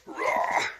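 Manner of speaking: loud grunt of effort. Real, not acted. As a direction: push out a kickstart grunt. Kicking over the kickstarter.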